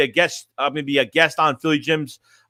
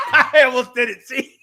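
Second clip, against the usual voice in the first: high, through helpless laughter; laughing; 9 semitones higher than usual. Real, not acted. Streamer nearly slips into the impression, laughing as he talks. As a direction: crack up while admitting it. Cracks up mid-joke.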